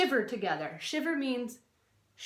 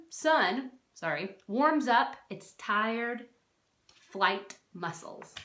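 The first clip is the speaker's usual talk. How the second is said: ordinary talk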